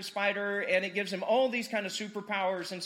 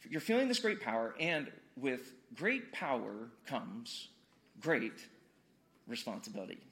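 solemn mentor's line; 9 semitones lower, 6 dB quieter than usual. Acted. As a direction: sink down softly onto the last word. Quotes the film's famous line.

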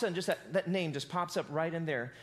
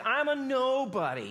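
higher, drawn-out mock whine; mock whining; 5 semitones higher, 4 dB louder than usual. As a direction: whine it slowly, imitating a complainer. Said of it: "He's whining, he's complaining, he's griping".

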